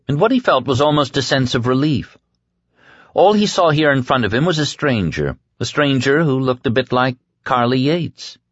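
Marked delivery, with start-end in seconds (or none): none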